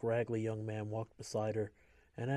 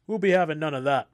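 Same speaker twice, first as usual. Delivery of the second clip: loud commanding shout; 5 semitones higher, 14 dB louder than usual. A narrator's reading. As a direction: bellow it across the room. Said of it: "an officer shouted".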